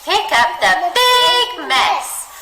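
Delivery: big said high, loud and slow; dramatic read of big mess; expressive big. Shown as a demonstration. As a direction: stretch the word big out grandly. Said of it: "say big in the same way".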